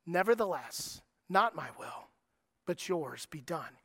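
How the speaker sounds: soft, low, yielding words; quiet surrender. Retold or imitated. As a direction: let go gently, trusting. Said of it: "the tone in which Jesus says".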